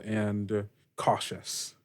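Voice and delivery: ordinary talk, nothing marked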